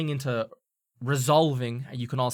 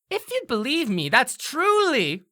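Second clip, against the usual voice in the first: sly; 12 semitones higher, 5 dB louder than usual. Acted. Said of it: "she'll say".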